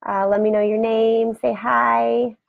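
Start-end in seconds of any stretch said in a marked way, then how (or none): none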